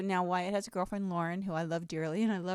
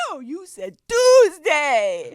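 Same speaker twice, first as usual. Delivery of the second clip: stubborn loud retort; 7 semitones higher, 16 dB louder, much slower than usual. Retold or imitated. Retold quarrel with husband.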